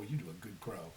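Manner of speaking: usual speaking voice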